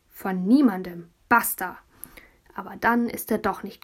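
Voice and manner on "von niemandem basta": loud, slow, final; heroic defiance. Acted. Boy on the balcony railing declaring freedom, loud defiance. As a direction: slam the door on it. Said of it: "hat er gesagt".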